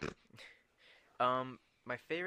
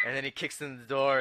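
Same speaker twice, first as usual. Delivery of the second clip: ordinary talk, nothing marked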